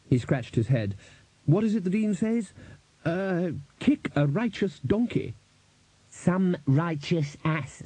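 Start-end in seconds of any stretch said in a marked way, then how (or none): 1.4-5.3 high, groping for the phrase; puzzled Arch-Chancellor. A narrator's reading. 6.1-7.8 high and drawn-out, word by word; careful correction. A narrator's reading. Ponder correcting the Arch-Chancellor's misquote.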